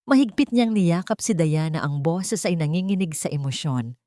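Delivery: ordinary delivery, nothing marked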